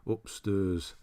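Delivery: put-on northern English accent, upstairs as "up-sturs"; wife's northern accent. Demonstrating his wife's speech, northern English accent. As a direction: turn the air vowel into a northern "ur". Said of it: "that sort of accent".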